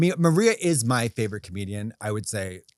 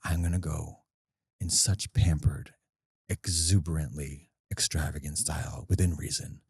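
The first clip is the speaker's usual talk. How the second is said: low, soft scheming purr; a diabolical demand told to the manager, low and hushed; 10 semitones lower, 8 dB quieter than usual. Acted. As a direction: lower and soften to sound like a cartoon villain plotting. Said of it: "I'm gonna do this in this voice 'cause it's diabolical".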